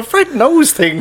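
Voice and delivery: high, drawn-out mock amazement; mock awe. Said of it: "The two of us are a gasp".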